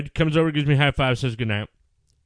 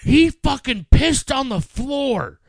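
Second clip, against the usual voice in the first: outraged; 10 semitones higher, 4 dB louder, slower than usual. Retold or imitated. Retelling wife's outraged report.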